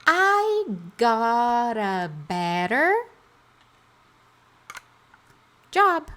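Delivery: chunk by chunk, pausing before the last word; I got a better, then a beat, then job; spoken slowly for students to repeat; slow drill. Shown as a demonstration. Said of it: "Então, vamos lá".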